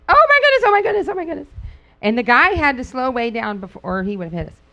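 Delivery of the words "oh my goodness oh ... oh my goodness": much higher, louder, repeated panic; wife in the passenger seat panicking at oncoming car; panicked gasp. Retold or imitated. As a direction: squeal it in alarm as a car bears down. Car nearly hits them.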